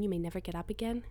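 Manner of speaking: ordinary delivery, nothing marked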